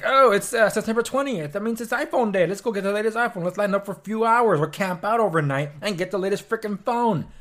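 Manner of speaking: high, loud mock-eager voice; mocking phone-crazy youth. Vlogger mocks young people lining up for iPhones, high eager mock voice. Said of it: "It's like".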